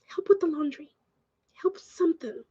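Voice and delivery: soft unvoiced whisper; whispered complaint about the brother-in-law; whispered aside. Real, not acted. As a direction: whisper it under your breath. Muttered aside.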